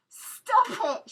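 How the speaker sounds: high, loud desperate shout; furious tearful cry. A narrator's reading. Girl beating his chest, shouting through tears. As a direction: scream at him while beating his chest. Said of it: "hammering her fists against his chest".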